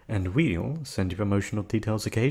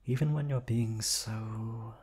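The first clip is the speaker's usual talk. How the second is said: creepy purr; 4 dB quieter, slower than usual. Acted. Creepy admiration of captive.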